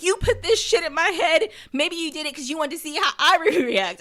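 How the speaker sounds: high, loud retold outburst; mock accusation. [name] turns it on Jim, mock accusing.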